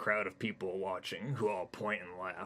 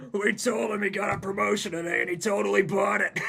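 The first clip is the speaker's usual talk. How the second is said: gleeful dinosaur; 13 semitones higher, 13 dB louder than usual. Acted. Laughing Triceratops explains.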